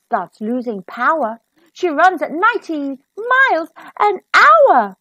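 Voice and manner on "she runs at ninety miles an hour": ordinary delivery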